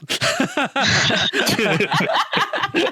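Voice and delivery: high, bursting laugh at the close; big laugh. Real, not acted. Host cracks up at the podcast sign-off, high bursting laugh.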